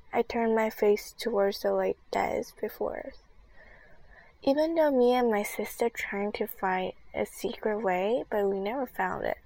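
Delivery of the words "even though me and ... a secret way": plain reading voice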